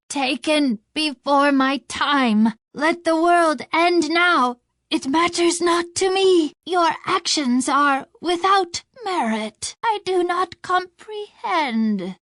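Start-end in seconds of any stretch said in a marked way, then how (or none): none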